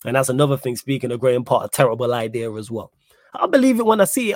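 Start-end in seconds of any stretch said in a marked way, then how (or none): 3.3-4.3 sceptical. Real, not acted. Sceptical about new ownership.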